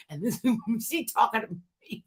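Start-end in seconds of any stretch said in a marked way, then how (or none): none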